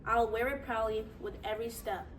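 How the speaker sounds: ordinary talk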